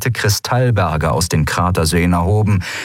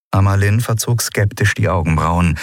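ordinary delivery, nothing marked

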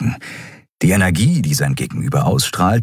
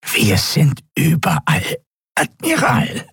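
much higher, croaking, pressed and nasal, falling "Admiral"; 9 semitones higher than usual. A narrator's reading. Reptile prisoner croaks at the admiral, pressed and nasal. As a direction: croak it through a pinched nasal throat. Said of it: "krächzte die gepresste, nasale Stimme".

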